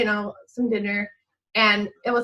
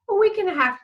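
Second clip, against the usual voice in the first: much higher, eager and quick; eager answer; 10 semitones higher, 3 dB louder, faster than usual. Retold or imitated. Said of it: "he goes".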